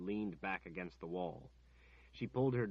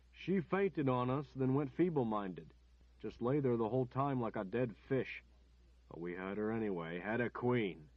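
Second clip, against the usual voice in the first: crude captor; 3 semitones higher, 6 dB louder than usual. A narrator's reading. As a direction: sneer it like a crude thug. Captor mocks the queen.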